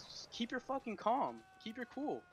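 slow, soft steadying voice; calm. Real, not acted. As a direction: settle them down gently. Calming panicking teammates.

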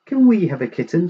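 high, quick excited plea; eager children's shout. A narrator's reading. Children asking for a kitten, eager shout. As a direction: rush it out high like keen children. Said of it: "shouted the Joneses' children".

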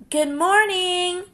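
high, slow, sweet greeting; a tiny bug sings out hello; friendly ladybug. Acted. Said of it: "said the friendly ladybug".

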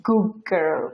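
"গুড গার্ল" with the d dropped into a long g, "guggirl"; assimilated good girl. Shown as a demonstration. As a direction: blend "গুড গার্ল" so the d becomes g. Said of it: "এই জন্য ওরা pronounce করতেছে".

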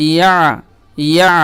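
ইয়া closed on a throaty ain; vowelless ain. Shown as a demonstration. Teacher spelling out jazm on ain, ইয়া closed in throat. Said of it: "আইনের উপর জজম রয়েছে".

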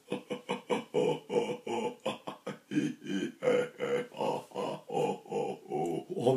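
hushed rhythmic chimp-like panting; chimpanzee exercise. Shown as a demonstration. Teacher shows Roswitha's chimpanzee exercise, soft breathy hoots. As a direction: pant softly through changing vowel shapes. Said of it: "The chimpanzee means you go".

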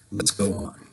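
plain reading voice

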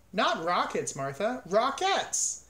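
"Rockettes" lifted high on its last syllable; corrective stress. A narrator's reading. Farmer corrects his cow, stressing the end of "Rockettes".

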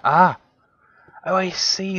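normal talking voice

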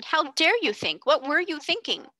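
high, pressing indignation; outraged demand. A narrator's reading. Headmistress outraged at a pupil thinking, high angry demand. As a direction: fire the questions at her. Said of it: "demanded Miss Minchin".

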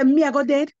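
defiant, fearless statement; bold defiance. Retold or imitated. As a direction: throw the words back with boldness. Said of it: "I don't know where I get this boldness from".

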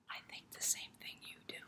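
whisper. Acted. Conspiratorial aside to children.

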